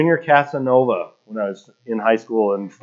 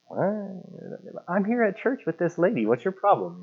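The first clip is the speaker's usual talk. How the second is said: high, soft, incredulous retort; retold affronted protest in a higher voice; mock indignation; 8 semitones higher, 9 dB quieter than usual. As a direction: play at being offended, pitched up but held back. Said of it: "I'm just like, Mad".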